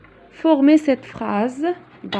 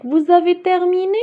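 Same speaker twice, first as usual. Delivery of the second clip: « vous avez terminé? » sung higher with a rising end; question intonation; 6 semitones higher than usual. Shown as a demonstration. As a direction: let the pitch climb through « avez » to « terminé ». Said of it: "l'intonation de voix est totalement changée". (voix qui monte, intonation de question)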